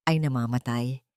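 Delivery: usual speaking voice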